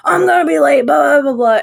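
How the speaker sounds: high, loud mocking rant; mock whining customer. Drive-thru customer fretting about being late, loud mockery. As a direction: exaggerate a customer's impatient griping.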